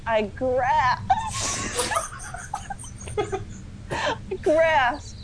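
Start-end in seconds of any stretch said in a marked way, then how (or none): 1.2-3.9 long high-pitched laugh; hysterical laugh. Real, not acted. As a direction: lose it in helpless laughter. Reader cracking up.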